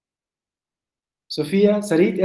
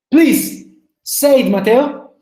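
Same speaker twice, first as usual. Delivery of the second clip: ordinary delivery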